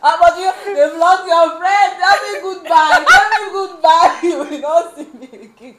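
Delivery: high, loud wail broken by laughter; hammed-up goodbye to a friend, laughing hard; laughing mock wail. Acted phone plea to only friend.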